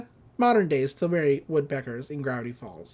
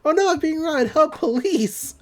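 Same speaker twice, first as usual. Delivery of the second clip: high, flat mock cry for help; hammed-up helpless lady, pitched high; mock damsel cry; 11 semitones higher, 4 dB louder than usual. Robbed lady calls out for police, mock high cry. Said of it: "She's like".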